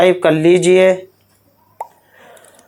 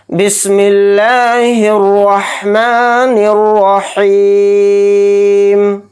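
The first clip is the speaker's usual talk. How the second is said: slow, drawn-out chanted tilawat; Quranic recitation; 3 semitones higher, much slower than usual. Acted. Teacher opening the lesson, slow chanted recitation. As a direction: intone each word slowly and reverently. Basmala before next surah.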